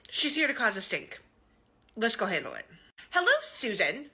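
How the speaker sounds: ordinary delivery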